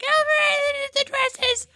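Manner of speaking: very high, drawn-out mock wail; hammed-up slow shriek; screaming panic. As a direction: squeal it in a panic. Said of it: "They all start screaming".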